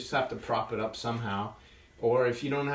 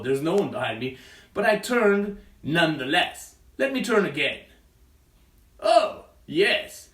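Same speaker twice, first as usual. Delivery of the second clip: loud, excitable silly wolf; wolf puppet voice; 4 semitones higher, 6 dB louder than usual. Acted. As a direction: act the confused wolf for kids. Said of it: "the big bad wolf".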